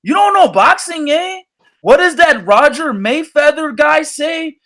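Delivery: imitating a Mexican fan in a put-on Mexican-American accent who says you don't know boxing; mock Mexican-American accent. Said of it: "That's how they talk".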